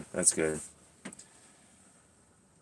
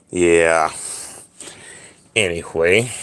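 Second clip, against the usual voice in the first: normal talking voice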